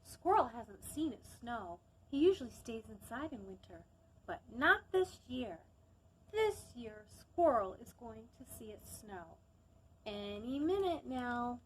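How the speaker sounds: gentle storybook narration; calm storytime reading; reading a story aloud. Acted.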